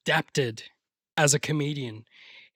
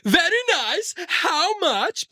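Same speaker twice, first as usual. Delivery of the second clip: Borat; 12 semitones higher, much slower than usual. Retold or imitated. Mocking people who repeat film quotes, put-on comic foreign voice. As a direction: parrot the comic foreigner in a high voice. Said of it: "like, Borat, for instance".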